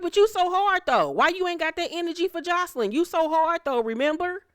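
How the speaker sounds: high-pitched mock questioning; mocking challenge. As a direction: needle her in a high voice. Mocks Amber for backing down.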